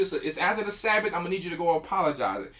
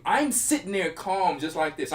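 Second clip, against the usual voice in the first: ordinary talk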